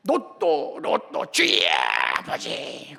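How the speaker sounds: hammed-up crying out in prayer, bursts falling away; mock fervent prayer. Preacher mimicking believers begging God for lotto, mock fervent prayer. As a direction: chant it like a desperate petitioner, mockingly.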